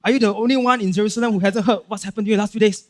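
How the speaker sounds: mock exasperation, rattled off fast; irritated. Preacher acts disciples answering the stranger, irritated. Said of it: "very irritated".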